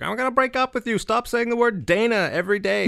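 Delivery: high, piercing mock-ex voice; mocked girlfriend threat. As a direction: put on a high-pitched nagging girlfriend. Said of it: "threatened by an ex who was like".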